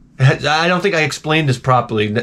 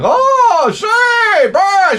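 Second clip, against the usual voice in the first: very high, loud shrill-pitched shout; mother-in-law bursting in, shrieking high and loud; 3 dB louder, much slower than usual. Retold or imitated. Mother-in-law barges into the bedroom, very high, loud shout. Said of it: "she's like".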